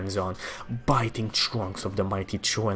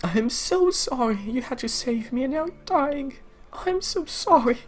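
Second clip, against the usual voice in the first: much higher, louder voice breaking into tears; crying sister; 5 dB louder than usual. Acted. Seraphim weeping over her dying brother, high and breaking. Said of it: "She is crying heavily".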